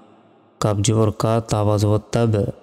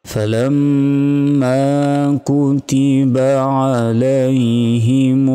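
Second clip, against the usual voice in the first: Quran recitation; 2 semitones higher, 3 dB louder, much slower than usual. Shown as a demonstration. Teacher recites the verse for students, slow and chanted. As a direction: intone each phrase slowly like a reciter.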